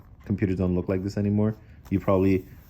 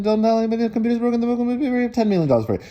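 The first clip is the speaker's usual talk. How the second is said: rushed whisper; 12 semitones higher, much faster than usual. A narrator's reading. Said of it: "He whispered in the old man".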